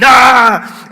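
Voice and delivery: loud, high yell; crazy-guy scream. Retold or imitated. Pastor imitating himself scaring the kids, wild scream. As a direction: let out a wild, sudden yell.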